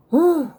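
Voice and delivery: puffing out an exhausted whew; breathless whew. Real, not acted. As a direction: blow out air, worn out. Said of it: "The old girl was out of breath".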